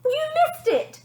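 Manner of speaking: high, loud accusing yell. Acted. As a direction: blame father for missing. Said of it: "she yelled".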